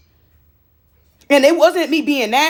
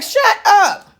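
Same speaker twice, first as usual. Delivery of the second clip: snapping at bird; 6 semitones higher, 4 dB louder than usual. Retold or imitated. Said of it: "I be like".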